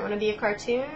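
ordinary talk, nothing marked

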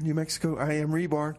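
ordinary delivery

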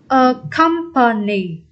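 "A company" slowly in separate syllables; slow demonstration. Shown as a demonstration. Teacher repeating the option for students, slow demonstration. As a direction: spell out "A company" sound by sound.

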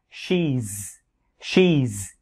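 "she's" as one long syllable ending in z; slow, drawn-out "she's"; contracted she's. Shown as a demonstration. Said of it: "viết tắt là he's".

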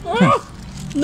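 sudden high alarmed cry; surprised cry. Real, not acted. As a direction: yelp as the food falls. Burger falls apart while eating.

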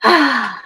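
audible sigh before answering; thoughtful sigh. Real, not acted. Guest asked where the field is now, sighing. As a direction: let out a sigh, then begin.

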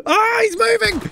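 high startled shout; real sudden alarm; startled yell. Real, not acted. Creature suddenly moves.

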